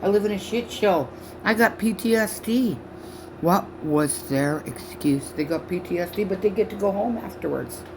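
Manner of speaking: normal talking voice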